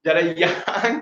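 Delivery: usual speaking voice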